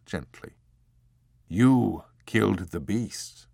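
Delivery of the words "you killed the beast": put-on slow, gentle regret from the old abbot; almost regretful. A narrator's reading. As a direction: explain it slowly, almost sorry. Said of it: "he explained, almost regretfully".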